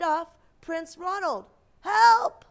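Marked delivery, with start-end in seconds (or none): 1.8-2.4 high, loud shriek for help; cry for help. Acted. Kidnapped prince screaming for help.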